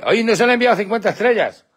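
high, sudden exclamation; delighted surprise. Real, not acted. Streamer noticing viewers sent him fifty stars, delighted surprise. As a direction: burst out at an unexpected gift.

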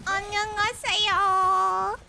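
안녕하세요 high, loud, last syllable stretched and rising; own Korean greeting. Shown as a demonstration. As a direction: sing out 안녕하세요 with a long final 요. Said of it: "makes fun of how I say it".

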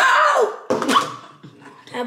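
tearful, slow protest; crying. Real, not acted. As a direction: sob out the words. Child upset, crying.

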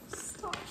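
a sharp high gasp of delight; gasp, overwhelmed by the gift; real startled gasp; shocked gasp. Real, not acted. Overwhelmed opening fan mail.

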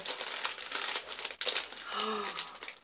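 long breathy gasp of delight. Real, not acted. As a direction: gasp as the next gift appears. Gasp opening next gift.

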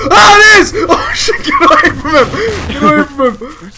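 high, loud frightened shouting; panicked yelling. Real, not acted. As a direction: shriek at the monster. Chicken attacks teammate.

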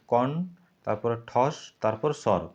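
কন, ঠস, স্বর spaced out slowly; slow syllable split. Shown as a demonstration. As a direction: split কণ্ঠস্বর slowly into its parts. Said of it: "তোমরা ভাঙো ভালো করে". (কণ্ঠস্বর ধীরে ধীরে দলে ভাঙা)

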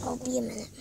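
whispered aside. Real, not acted. Kid whispering to viewers while stepping away. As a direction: whisper it so nobody hears.

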